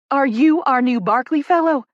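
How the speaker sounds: put-on thick Southern accent. A narrator's reading. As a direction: greet the newcomer in a bold Southern accent. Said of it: "She's got a thick Southern accent".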